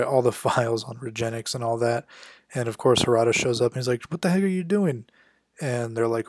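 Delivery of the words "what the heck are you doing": indignant, much higher pitch; outraged, higher. Retold or imitated. Harada catches them wiping his files, higher and outraged. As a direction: demand an answer, outraged.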